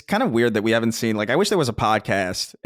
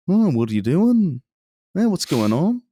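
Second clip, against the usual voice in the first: drawn-out Scouse lilt, higher pitch; Liverpool accent; 6 semitones higher, slower than usual. Acted. Podcaster showing co-host how Liverpool sounds, mock Liverpool accent. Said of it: "Liverpool is very, like".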